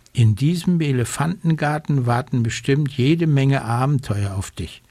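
usual speaking voice

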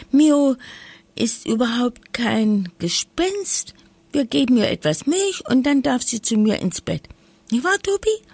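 put-on high, eager, rushing girl's voice; excited little girl. A narrator's reading. Lisa begging her brother to keep the cat, excited. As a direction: gush with childish delight. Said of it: "rief Lisa begeistert".

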